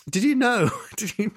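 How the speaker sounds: high laughing question. Retold or imitated. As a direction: ask it through a giggle. Laughing while asking.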